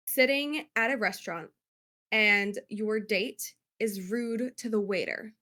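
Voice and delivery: ordinary talk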